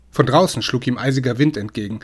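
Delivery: ordinary delivery, nothing marked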